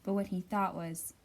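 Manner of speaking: plain reading voice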